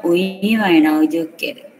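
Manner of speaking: ordinary talk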